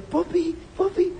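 high cooing call to a pet; high sing-song pet name; sweet pet call. Retold or imitated. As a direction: coo it up high like calling a puppy. Calling the dog sweetly.

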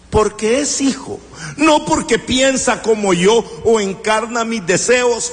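usual speaking voice

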